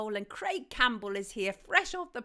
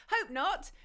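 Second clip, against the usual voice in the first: hope not, lifted up higher at the end; asking it lightly, voice rising high; hopeful rise; 7 semitones higher than usual. Real, not acted. Host answers listeners on the sound, hopeful rising. Reply to listeners on audio volume.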